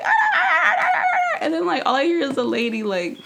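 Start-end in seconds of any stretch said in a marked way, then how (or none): 0.0-1.4 mock shrieking. Retelling the woman's outburst, high mock shrieks. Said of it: "She's like".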